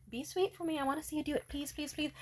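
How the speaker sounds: soft, high wheedling; sweet pleading. Retold or imitated. Woman sweetly begging her lover, playful pleading. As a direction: beg him in a small cute voice. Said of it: "And I'd be like".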